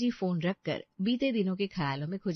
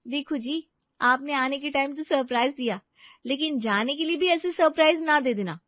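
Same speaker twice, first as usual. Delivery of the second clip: higher, louder wife's voice; insistent young wife; 6 semitones higher, 6 dB louder than usual. Acted. Wife asking husband when they will leave, insistent. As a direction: press him firmly, pitched up and loud.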